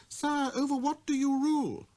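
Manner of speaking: put-on high, wondering child's voice; little prince. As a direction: puzzle it out like a small boy.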